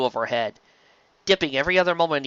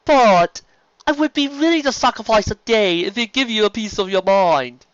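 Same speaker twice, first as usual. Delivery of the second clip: higher, louder refined pony voice; Rarity's voice; 9 semitones higher, 6 dB louder than usual. Acted. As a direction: play Rarity pleading sweetly.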